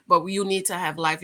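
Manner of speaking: normal talking voice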